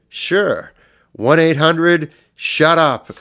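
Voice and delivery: high, loud, slow mocking retort; sarcastic brush-off. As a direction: throw the fake number back to get rid of them. Snarky reply to nurse's question.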